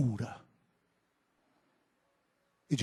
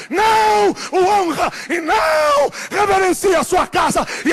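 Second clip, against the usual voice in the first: high, very loud impassioned shout; full-force preaching; 15 dB louder than usual. Real, not acted. Preacher lamenting that God's people do not honour him, shouted rebuke. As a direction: build from raised voice to sustained full-throated shout.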